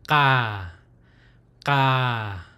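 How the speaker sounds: "ka" open and throaty, slightly stressed; open syllable ka. Shown as a demonstration. Teacher demonstrating the open syllable "ka". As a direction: let "ka" sit open at the back of the throat. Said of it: "that's how you'll pronounce the open syllable".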